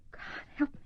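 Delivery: breathy whispered prayer; whispered plea. Acted. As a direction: beg God in a whisper. Frightened prayer under her breath.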